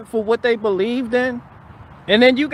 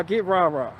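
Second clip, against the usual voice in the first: quiet repeat; 4 semitones lower, 6 dB quieter than usual. Real, not acted. Softly repeated rah-rah. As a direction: get rah-rah dropped soft. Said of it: "If you won't get rah-rah".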